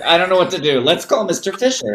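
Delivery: high, loud, flustered student voice; mock panicked student. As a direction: play the helpless kid who needs rescuing. Panicked students quoted by teacher.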